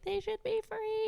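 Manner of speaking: high-pitched mock quote. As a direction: squeak it out like a naive idealist. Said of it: "they're like".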